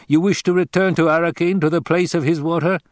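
high, eager query; questioning Fremen. A narrator's reading. Fremen checking where Hawat wishes to go, intent.

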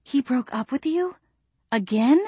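high, shocked question; friend's incredulous gasp, pitch rising. A narrator's reading. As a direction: ask it aghast, voice lifted. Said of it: "Andrea gasped".

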